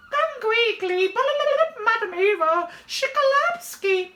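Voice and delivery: high, loud Polish maid in a panic; shrieked, hammed-up alarm call; loudly clucking maid. A narrator's reading. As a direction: squawk frantically like a flustered hen. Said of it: "clucking loudly".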